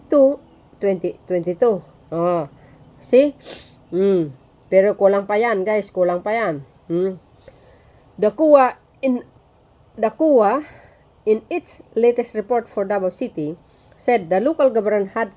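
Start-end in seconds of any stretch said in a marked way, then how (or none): none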